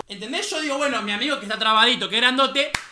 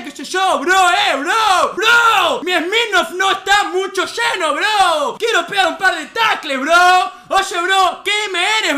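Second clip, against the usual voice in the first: loud, raised, hammed-up shouting; aggressive rant; 4 semitones higher, 8 dB louder, slower than usual. Rugby player defending his party, hammed-up belligerent shouting. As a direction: bellow each "bro" like a mock challenge.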